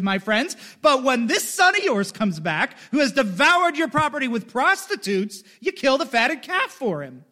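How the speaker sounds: the elder son throwing it back at his father; angry son confronting his father; resentful elder son. Acted. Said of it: "but he answered his father".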